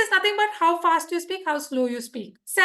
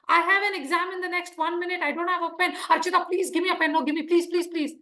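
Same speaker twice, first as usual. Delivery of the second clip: fast, begging for a pen; panicked student begging classmate for pen, fast; urgent pleading. Shown as a demonstration. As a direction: rush it out, pleading please please. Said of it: "That's how I'm gonna behave".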